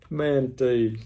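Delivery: slow, laughing relief. Real, not acted. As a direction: chuckle the word out slowly. Relief after hearing answer.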